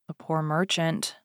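ordinary delivery

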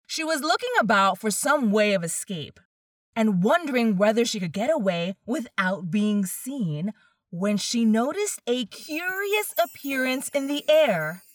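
usual speaking voice

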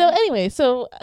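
high loud laughing restart. Real, not acted. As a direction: still giggling as she goes on. Recovering from laughter.

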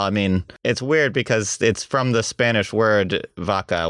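usual speaking voice

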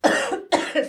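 harsh choking coughs; coughing fit. Real, not acted. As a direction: cough hard like water went down wrong. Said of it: "I'm choking on water".

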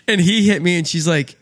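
ordinary delivery

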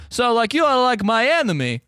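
high, solemn mock Russian accent; earnest Russian man confronting a Brit; high Russian accent, serious.

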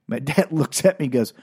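normal talking voice

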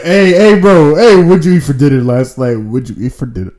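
loud mock call to friend; imitating friend. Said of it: "You be whispering the same sentence".

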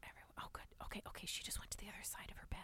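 unvoiced hush; whispering. Real, not acted. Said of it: "I gotta be quiet".

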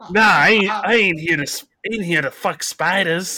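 loud, high mock New York accent; badly faked Australian turning New York; muddled New York accent. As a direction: botch Aussie into brash New Yorker. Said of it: "you sound like you moved from New York".

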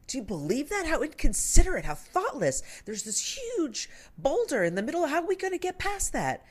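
high, indignant storybook voice; chicken outraged. Retold or imitated. Chicken grumbling to wombat about the boulder, high indignant voice. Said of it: "the chicken says".

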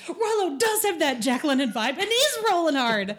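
laughing through the words. Real, not acted. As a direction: giggle it out. Laughing while repeating.

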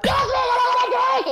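high mock drunken scream; imitating a drunk customer's yell. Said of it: "she screamed".

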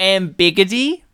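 "ambiguity" as am-BIG-ity; teasing wrong "ambiguity", stressed on BIG; mangled pronunciation. Shown as a demonstration. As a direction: shorten "ambiguity" to am-BIG-i-tee. Deliberately mangling the corrected word.

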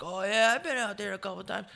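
lightly imitating the driver. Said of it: "He's like".

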